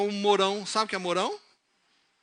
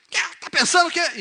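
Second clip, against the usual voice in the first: high, loud acted outburst; explosive boy; 11 semitones higher, 9 dB louder than usual. Hot-tempered boy lashing out at someone, explosive. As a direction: snap back in mock fury. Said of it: "muito explosivo".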